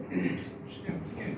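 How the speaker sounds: real nerves, breathy hush; nervous whisper. Real, not acted. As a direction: admit you're scared under your breath. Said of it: "My voice is shaking".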